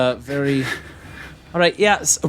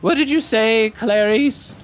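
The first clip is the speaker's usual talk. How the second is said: kobold voice; 8 semitones higher than usual. Acted. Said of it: "So the other one's like".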